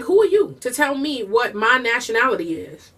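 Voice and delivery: usual speaking voice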